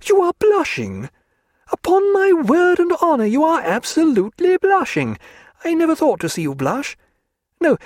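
Diana's voice. A narrator's reading. Diana teasing Stephen about blushing, high and playful. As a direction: tease him fondly in a high bright lady's voice.

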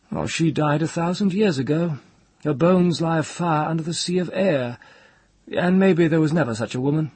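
high, lofty, gently mocking voice; gentle mockery. A narrator's reading. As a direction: taunt grandly, voice lifted high. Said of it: "Ged said with the same gentle mockery".

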